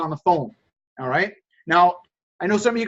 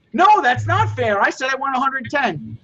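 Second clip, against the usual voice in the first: stubborn customer; 6 semitones higher than usual. Retold or imitated. Seller imitating a customer who rejects the offer, mock indignant and higher. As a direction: play the customer digging in his heels.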